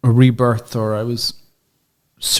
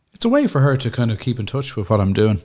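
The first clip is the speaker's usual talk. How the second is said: usual speaking voice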